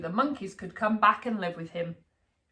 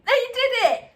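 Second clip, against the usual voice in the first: put-on giggly boy; 14 semitones higher, 6 dB louder than usual. A narrator's reading. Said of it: "laughed Alfie".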